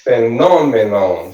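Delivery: Phenomenon with NOM as the strong syllable; stress on NON. Shown as a demonstration. Teacher showing the tonic syllable, Phenomenon stressed phe-NOM-enon. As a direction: punch the second syllable of Phenomenon. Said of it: "so the non é a".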